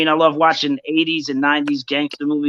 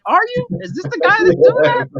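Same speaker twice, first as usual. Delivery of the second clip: mock disbelief; 3 dB louder than usual. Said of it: "And I'm like".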